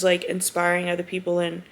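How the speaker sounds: ordinary talk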